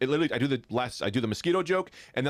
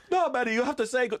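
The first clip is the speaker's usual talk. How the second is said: high-pitched, badly faked Bangladeshi-sounding accent; mangled mock Bangladeshi accent; horrible accent; 11 semitones higher than usual. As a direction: imitate James Corden in a hopelessly wrong accent. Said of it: "that's a horrible accent".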